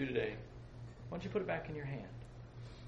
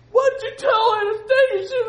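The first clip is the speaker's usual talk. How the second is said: high, loud wailing mother on the phone; crying mom on phone; 19 dB louder than usual. Retold or imitated. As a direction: wail it like a tearful mother calling. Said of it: "call me up and say".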